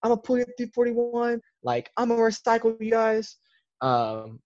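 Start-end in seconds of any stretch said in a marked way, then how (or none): 0.0-3.4 lightly mimicked high-pitched drill instructor; a hint of a strict instructor barking threats; imitating strict instructor. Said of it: "screaming at the flight".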